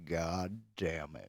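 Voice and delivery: low slow monster sniff and curse; skunk ape grumble. Retold or imitated. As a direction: sniff then growl it out slowly, low like a beast. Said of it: "He's like".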